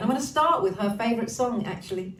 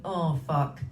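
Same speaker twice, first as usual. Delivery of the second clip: ordinary talk, nothing marked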